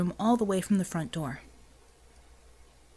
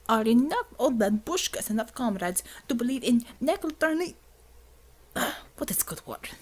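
put-on Russian accent; 4 semitones higher than usual. Xavier rants, Russian accent.

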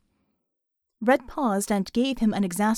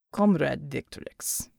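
put-on slow low Russian-accented aside; muttered Russian accent; 7 semitones lower, 3 dB quieter, slower than usual. A narrator's reading. Untermensch secretly calling Vicky, a hint of Russian accent mutter. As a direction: mutter it so only she hears. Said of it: "he muttered".

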